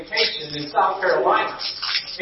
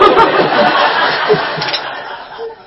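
loud, high burst of laughter; cracking up at his own joke; 12 semitones higher, 6 dB louder than usual. Real, not acted. Pastor laughing at his own punchline, loud laughter.

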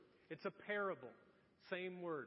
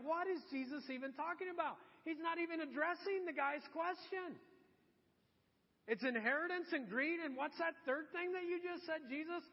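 higher-pitched, mock-bewildered protest; puzzled, raised-pitch questioning; baffled crowd; 8 semitones higher than usual. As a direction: imitate listeners who can't follow at all. Acts out the baffled audience.

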